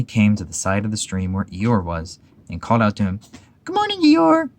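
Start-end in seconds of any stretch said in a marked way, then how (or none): 3.6-4.5 high, loud little Piglet call; Piglet shouting. Acted. Piglet calls a greeting to Eeyore by the stream, high and loud. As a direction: call across to a friend, high and bright. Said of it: "shouted Piglet".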